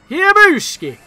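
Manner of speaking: high, loud silly shout; playful battle cry. Real, not acted. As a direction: yell a goofy attack call.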